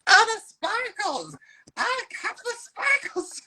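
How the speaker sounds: high, slow gushing squeal; gushing tourist. Pretend. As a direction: coo over the sparkles like a wide-eyed fan. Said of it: "you're gonna be like".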